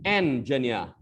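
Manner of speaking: "एन जनिया" as en-juh-niya; corrected pronunciation. Shown as a demonstration. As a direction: say "एन जनिया" with en first, no jin. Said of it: "कैसे बोलेंगे इसको?".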